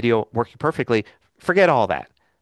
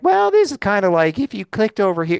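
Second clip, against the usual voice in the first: mock casual rambler, pitched much higher and louder; rambling casual self; 8 semitones higher, 4 dB louder than usual. Mocking his own casual rambling before becoming professional, higher and louder. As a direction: toss it off like an unprofessional ramble. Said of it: "not be like".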